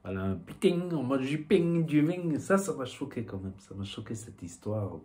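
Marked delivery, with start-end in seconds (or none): 0.2-2.4 Southwest French accent with ringing -ing endings; southern French accent. Shown as a demonstration. Vlogger showing how his accent comes back, southern French accent. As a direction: lay on the southern French twang. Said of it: "mon accent, il revient".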